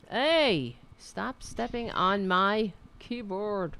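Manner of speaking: ordinary delivery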